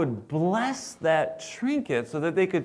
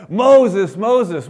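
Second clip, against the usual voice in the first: unexpected voice of God; 6 semitones higher, 11 dB louder than usual. Acted. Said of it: "It wasn't the voice you expected".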